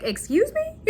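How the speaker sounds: high, shocked disbelief; shocked Excuse me. Retold or imitated. Luke stunned by his wife's permission, high shocked. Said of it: "And he's like".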